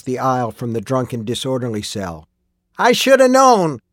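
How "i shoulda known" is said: high, loud outburst of rage; furious shout. A narrator's reading. Old miner realizing who the killer is, furious shout. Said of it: "he shouted".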